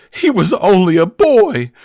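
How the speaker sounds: high, slow, hammed-up wail; woman's mock lament. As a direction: imitate a woman crying out in dismay, drawn out. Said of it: "a woman goes".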